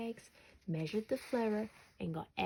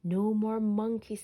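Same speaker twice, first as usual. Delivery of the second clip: louder, final warning; firm mum; 3 semitones higher, 8 dB louder than usual. A narrator's reading. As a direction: mum laying down the law for good. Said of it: "said Mum".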